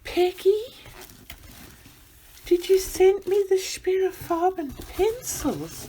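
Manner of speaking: high-pitched, thrilled question; real excitement climbing high; excited disbelief. Real, not acted. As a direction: ask in delighted disbelief. Recognizing a coveted gift.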